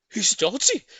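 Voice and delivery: high, loud mocking chorus; animals crying out. A narrator's reading. Animals mocking Mugglewump, high squealing. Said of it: "they cried".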